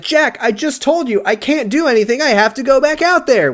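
high-pitched mock yell; exasperated retold yell, pitch way up; imitated yell. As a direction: act out yelling at him without full volume. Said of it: "she, like, yells this".

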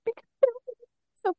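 very high, choked-up squeak; overcome with emotion. Real, not acted. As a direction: squeak it out as if about to cry. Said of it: "I'm getting really emotional".

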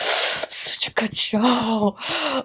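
soft, drawn-out sigh of admiration; gasp, then sigh it out slowly; overwhelmed fondness. Real, not acted. Overwhelmed fan sighing over the show.

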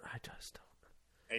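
whispered aside. Real, not acted. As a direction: breathe it under your breath. Muttering near the mic.

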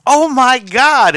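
high, slow gushing "oh my God"; gushing over baby. Retold or imitated. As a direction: coo over the baby like a fan. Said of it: "looks at the baby and says".

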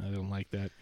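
ordinary talk

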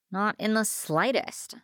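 mildly groaning denial; groaned. A narrator's reading. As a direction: drag it out in frustration. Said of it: "I groaned".

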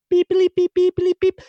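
high, loud beeping news tone; vocal beeps. Shown as a demonstration. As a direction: sing the bulletin beeps up high. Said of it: "we're gonna do this the good old-fashioned way".

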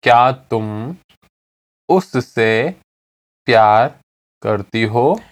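slow, in separated pieces; slowly. Shown as a demonstration. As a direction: pause long between words so learners can follow. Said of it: "I'll say it again slowly".

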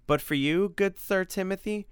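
grateful monster, effusive. Acted. Freddy thanks Sir Timothy for saving him, effusive. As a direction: hail your rescuer with mock-courtly flourish.

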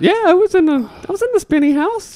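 put-on childlike squeal. Childlike excitement recalling ride.

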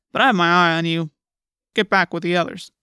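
stern officer's voice; gruff policeman. A narrator's reading. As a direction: send them away with authority. Policeman warns the girl.

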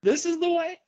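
"This is the way?" pitched very high with a rising end; "way" lifted into a squeaky high question; questioning inflection. Shown as a demonstration. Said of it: "change our inflection on that quote".

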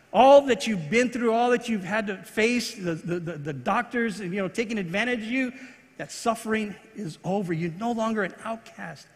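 normal talking voice

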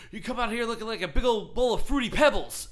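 loud, high trash talk; taunting wrestler. Retold or imitated. Wrestler insulting Cena before the crowd, taunting. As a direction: heckle it like a wrestler in the ring.

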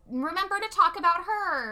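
high singsong reminder; lightly mock an eight-year-old's nagging; imitating little sister. Voicing eight-year-old sister's text.

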